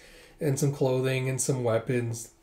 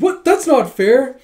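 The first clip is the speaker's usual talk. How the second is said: much higher and louder, a whiny protest; fangirl whine; 11 semitones higher, 10 dB louder, slower than usual. Acted. Admirers protesting the hug refusal, high whiny voice. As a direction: pout it like a jealous fan.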